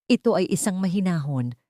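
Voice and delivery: plain reading voice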